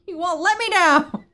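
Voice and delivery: loud, high scolding mom voice. Acted. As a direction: scold them like an upset mom. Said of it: "my mom voice is out".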